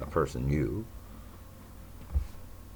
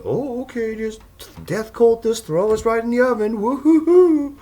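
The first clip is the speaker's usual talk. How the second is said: very high, loud, cackling mock voice; mocking caricature; 9 dB louder than usual. Sneering at imagined compliant victims in a shrill, cackling voice. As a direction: shriek it like a giddy fool.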